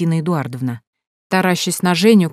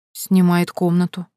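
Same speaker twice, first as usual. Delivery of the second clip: plain reading voice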